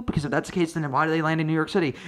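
ordinary delivery

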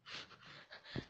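soft, voiceless chuckle; quiet run of breathy laughter. Real, not acted. Streamer tickled by her chat, chuckling quietly. Amused while chatting with viewers.

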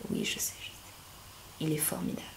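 a joking whispered aside; playful whisper. Acted. Praising her own accent to viewers, in a teasing whisper. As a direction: whisper it to yourself, pleased with your own accent.

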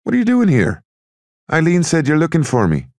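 high, loud questioning of a buddy; calling out. A narrator's reading. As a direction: pitch it up high and call out. Cork calls to Sonny outside.

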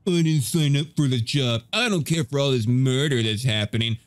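slow, mocking quote of a whiner; drawn-out mock complaint; mocking imitation.

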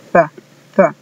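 the with tongue between teeth; English th. Shown as a demonstration. Teacher drilling the word the for students. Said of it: "Z como la pronuncian los españoles".

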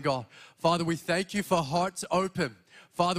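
ordinary talk

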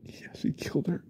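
whispered confession; hushed guilty whisper; shaken whisper. Acted. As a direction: whisper it as the truth sinks in. Confession to himself.

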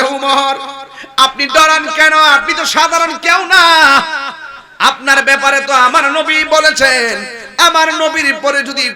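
loud, high declaimed reassurance; calling out. Acted. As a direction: call out as companions to their leader. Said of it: "সাহাবীরা ডাক দিয়া বলে". (উঁচু গলায় ডাক দিয়ে বলা)

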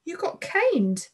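high and slowed right down, amazed; a boy gasping in disbelief at his friend's news; shocked gasp of disbelief. Acted. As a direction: let it out wide-eyed, stunned. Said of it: "Oz's eyes were on stalks".

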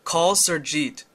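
Surjit spelled out slowly and loudly for the phone; slow, careful command. Shown as a demonstration. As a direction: say "Call Surjit" slowly and clearly so the app catches it. Let's try one last time.

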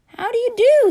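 very high, prim little voice; high polite greeting. Acted. Alabama politely greeting neighbors, very high voice.

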